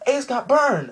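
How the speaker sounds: high, loud imitation of onlookers; shocked cry. Onlookers crying out, retold.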